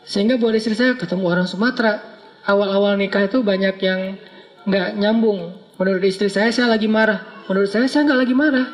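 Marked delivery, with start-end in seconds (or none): none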